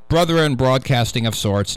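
normal talking voice

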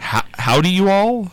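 lightly put-on Southern accent. Shown as a demonstration. Host coaches co-worker on the greeting, Southern accent. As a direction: show off a mild Southern accent. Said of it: "You gotta get the accent going".

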